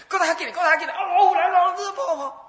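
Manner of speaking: high, loud, laughing panicked calls; hammed-up alarm as if a tiger is behind you; mock panic. Preacher acting out fleeing a tiger, mock panic.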